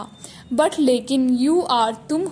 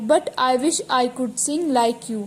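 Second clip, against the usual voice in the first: slow dictation reading; much slower than usual. Shown as a demonstration. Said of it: "teacher reads the sentence slowly".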